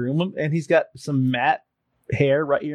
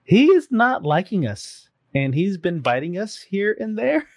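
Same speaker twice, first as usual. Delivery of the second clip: high, careful voicemail voice; retold groomer's apologetic high voice; imitated groomer; 6 semitones higher than usual. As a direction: break bad news delicately, pitched up. Voices groomer's awkward phone message.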